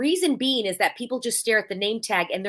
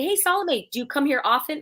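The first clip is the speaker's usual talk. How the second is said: upbeat, higher hello; friendly greeting demo; 6 semitones higher than usual. Shown as a demonstration. Showing networking greeting, cheerful and higher. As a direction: greet her by name, lifted and cheerful. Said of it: "and then I'll say".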